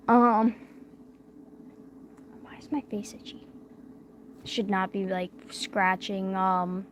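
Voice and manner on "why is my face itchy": whisper. Real, not acted. Muttering to self on stream.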